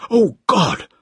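high-pitched cry of alarm; villain suddenly panicking; panicked scream. A narrator's reading. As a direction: cry out in horror, desperate to flee. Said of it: "and screamed".

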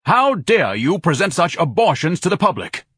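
high, loud indignant tirade; outraged critic. A narrator's reading. Ignatius attacks the art guild ladies, outraged. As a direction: denounce the paintings furiously.

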